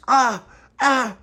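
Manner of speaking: squeaky frightened yelp; scared cry. Acted. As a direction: yelp in fear.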